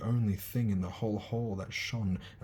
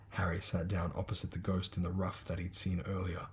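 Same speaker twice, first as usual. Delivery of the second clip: usual speaking voice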